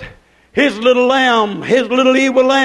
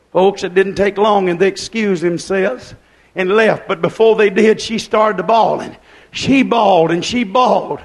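ordinary talk

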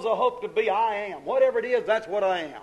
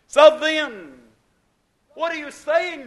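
loud, higher rhetorical ask; booming question; 6 semitones higher, 9 dB louder, slower than usual. Real, not acted. Preacher to congregation, loud rhetorical question. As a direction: boom out the question.

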